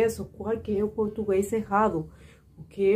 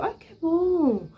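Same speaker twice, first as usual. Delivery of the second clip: delighted; 8 semitones higher, much slower than usual. Retold or imitated.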